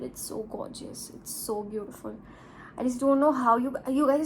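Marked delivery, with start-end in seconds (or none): 0.0-2.2 breathy awed whisper. Real, not acted. Awed by fan sketch.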